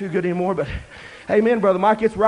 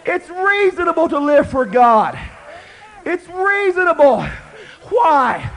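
loud, higher preaching shout; 6 semitones higher, 6 dB louder, slower than usual. Acted. Preacher shouting to the congregation that living for God is reasonable. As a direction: hammer the point home.

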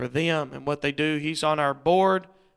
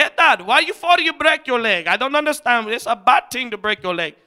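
lightly imitated Caribbean English accent, louder and higher at first; 5 semitones higher, 5 dB louder than usual. Preacher quoting his Honduran goddaughter, light Caribbean English accent. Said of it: "that Caribbean accent".

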